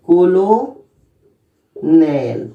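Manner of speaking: कोलो, pause, नेल, split in two; syllable by syllable. Shown as a demonstration. Teacher splitting the word for students, paused. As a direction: break कोलोनेल into कोलो and नेल. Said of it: "लेकिन English में सीओएलओएनईएल".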